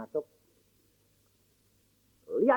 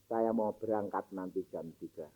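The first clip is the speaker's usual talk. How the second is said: put-on much softer, lower voice of a dying child; weak dying child; 11 semitones lower, 12 dB quieter than usual. Dying daughter tells her father she leaves at three, faint and low. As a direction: breathe it out faintly, almost gone.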